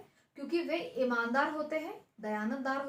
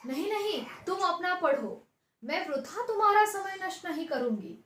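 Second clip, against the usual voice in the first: ऊँचे, ज़ोरदार सुर में मना करती सास; तेज़ आवाज़ में बहू को टालती हुई; सास का आग्रह, ऊँची आवाज़ में; 7 semitones higher, 6 dB louder than usual. Acted. (सास बहू को पढ़ने को कहती, ऊँची आवाज़ में)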